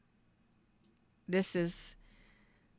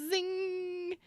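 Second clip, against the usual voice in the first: very high, squeaky sung "zing" like a spark; zing sound; 12 semitones higher than usual. Shown as a demonstration. Reader showing her buzzing energy, squeaky high zing. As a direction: make your energy zap out in one squeal. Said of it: "My energy is like".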